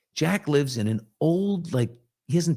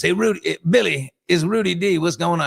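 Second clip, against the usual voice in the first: put-on raised, louder old Hollywood schmoozer; Rudy's voice; 6 semitones higher, 4 dB louder than usual. Rudy phoning Billy to chat, in a louder schmoozy voice. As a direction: call up your buddy like a showbiz fixer. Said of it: "He goes".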